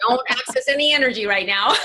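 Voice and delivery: bossy commander voice, quick; a playful brain commander shutting everything down; inner commander. Pretend. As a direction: bark orders like a little boss in the head. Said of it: "the little commander in chief".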